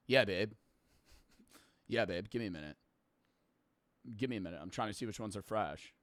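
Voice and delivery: low, soft distracted boyfriend; distracted mumble. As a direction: mumble it while busy shopping.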